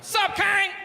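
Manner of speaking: high, far-voiced yell; aggressive shout. Comic hailing [name] on Sunset, high shout. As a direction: holler at him across the boulevard.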